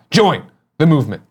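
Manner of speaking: upbeat sponsor ad read. Acted. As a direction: read it like a commercial. Reads sponsor ad.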